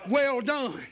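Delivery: high, loud fiery preaching; preaching at full force. Acted. Preacher stirring up the church, loud and high. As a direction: shout it out to the whole church. Preacher voicing Paul, climaxing.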